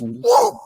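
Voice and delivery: high falling whoop as he drops; falling goblin whoop. Acted. Goblin doing an involuntary split while falling, high whoop. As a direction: let it slide down like doing the splits. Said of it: "as he goes".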